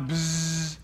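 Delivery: a short buzz through the lips; buzzing bee sound. Shown as a demonstration. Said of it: "What's a B sound like?".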